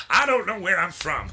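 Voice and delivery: a hint of a Louisiana accent; lightly put-on Louisiana guy. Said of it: "a Louisiana kind of guy".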